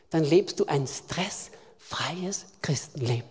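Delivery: soft, slow whispered promise. Acted. Preacher whispering the promise of a stress-free life to the church. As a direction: drop to a whisper to land it. Sermon punchline dropped to whisper.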